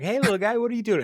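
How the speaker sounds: put-on high, cheerful baby-talk voice for the piglet; Chris cooing at the pig. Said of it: "And Chris is like".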